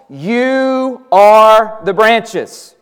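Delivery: very slow, each word weighed; emphatic quote. Retold or imitated. Preacher quotes Jesus to his followers, slow and weighty. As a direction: stretch out You are the branches.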